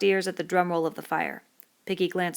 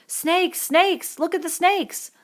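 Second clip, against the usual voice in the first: terrified scream, much higher; little boys shrieking in fright; 12 semitones higher, 6 dB louder, much slower than usual. Acted. Little boys screaming at the burning creepers. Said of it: "The little boys screamed".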